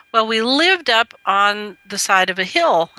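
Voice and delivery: ordinary talk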